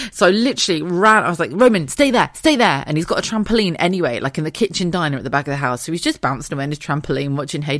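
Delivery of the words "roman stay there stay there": raised, higher urgent call. Retold or imitated. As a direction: call out to a child to stay put. Calling to child while rushing off.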